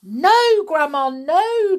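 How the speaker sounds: high, loud, alarmed protest; calling back. A narrator's reading. George calls back to stop Grandma, alarmed shout. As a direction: shout a desperate objection upward. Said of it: "George called back".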